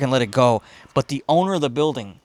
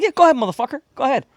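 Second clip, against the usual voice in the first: high-pitched, louder mock defiance; pitched-up, indignant comeback; defiant retort; 10 semitones higher, 4 dB louder, much faster than usual. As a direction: throw it back as a retold dare. Retold standoff with building owner.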